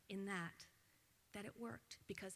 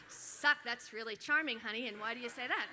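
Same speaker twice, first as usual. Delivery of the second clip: mock indignant mother; 3 semitones higher, 9 dB louder than usual. Mother retelling her reply to her son, mock indignant. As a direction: feign shock at the rude word.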